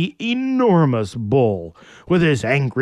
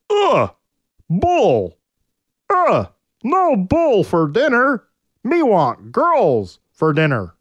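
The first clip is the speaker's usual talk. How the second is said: angry caveman; 8 semitones higher, 3 dB louder, slower than usual. Acted. Orion shouting at bull.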